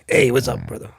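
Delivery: lightly voiced Chicago buddy; Joe Carnahan. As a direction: give a hint of a Chicago guy's greeting. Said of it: "He's a Chicago boy".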